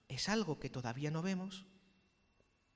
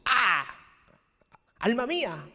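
loud call to the soul; 6 semitones higher, 9 dB louder, slower than usual. Acted. Calling out to his own soul.

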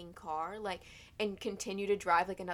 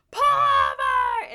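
high, drawn-out full-force yell; scream; 17 dB louder, much slower than usual. Retold or imitated. Passenger screaming at the driver to stop, loud and high. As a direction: scream it like an emergency. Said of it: "I just get up and scream".

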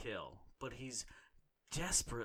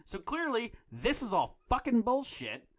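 angry outburst; 11 semitones higher, 7 dB louder than usual. Real, not acted. Rant peaking in outrage.

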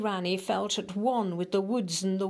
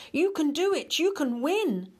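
high encouraging shout; cheering shout; 8 semitones higher, 4 dB louder than usual. Acted. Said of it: "shouted the animals".

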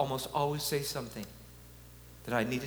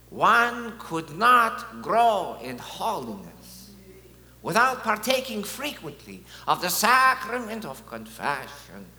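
put-on heavy Polish accent, higher, slow and louder; John Paul II accent; 5 semitones higher, 6 dB louder, slower than usual. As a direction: quote him in a slow, solemn Polish accent. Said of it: "how can I not use his accent?".

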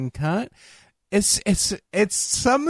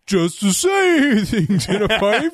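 whale voice; 8 semitones higher, 3 dB louder than usual. Retold or imitated. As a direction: stretch words like Dory's whale. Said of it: "her idea of speaking whale".

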